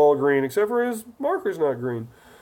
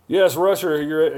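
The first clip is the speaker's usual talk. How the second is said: usual speaking voice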